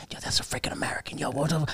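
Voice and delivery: whisper. Retold or imitated. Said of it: "It's like".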